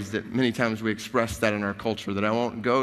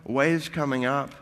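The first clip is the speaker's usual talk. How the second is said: usual speaking voice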